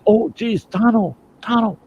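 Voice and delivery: high, loud acted desperation; desperate pleading. Bitten Lone Ranger begs Tonto for the doctor's word, high desperate pleading. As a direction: plead for news in pained panic.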